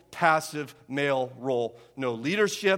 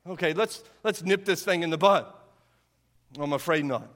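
ordinary delivery

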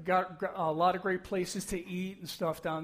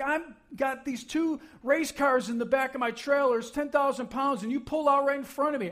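higher-pitched mock angry driver; raised-voice retold complaint; retold yelling; 6 semitones higher than usual. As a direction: lightly re-enact an irate driver's yell. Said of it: "he starts yelling out his window".

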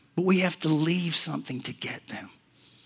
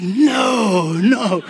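loud, high "no" of refusal; comic wail of reluctance; mock protest; 6 semitones higher, 9 dB louder than usual. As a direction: balk loudly, drawing out each no. Retold balking at God's nudge.

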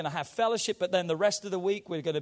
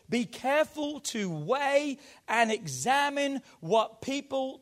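slow, raised preaching voice; emphatic scripture reading; 4 semitones higher, 5 dB louder, slower than usual. Acted. Pastor reading the Message Bible to church, slow and emphatic. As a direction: proclaim it in drawn-out, weighty phrases.

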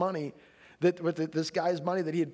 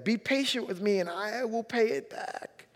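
begging; 6 semitones higher than usual. A narrator's reading. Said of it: "fell to his knees and begged".

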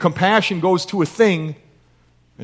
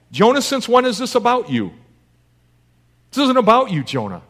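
higher, pressing rebuke to Jonah; rebuking Jonah; 6 semitones higher than usual. Real, not acted. Preacher confronting Jonah about his self-focus, higher and insistent. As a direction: call Jonah out, pitch up.

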